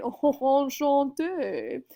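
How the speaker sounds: drawn-out, loud faux-fancy "enchanté"; mock-fancy French. Teacher imitating people trying to sound fancy in French, mock-fancy. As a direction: pretend to be French and fancy, stretching it out. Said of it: "pretend to be French or try to sound fancy".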